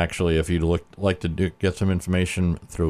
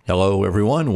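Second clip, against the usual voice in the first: usual speaking voice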